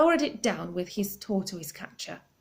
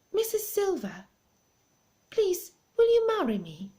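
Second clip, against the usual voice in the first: nervous proposal; 11 semitones higher than usual. Acted.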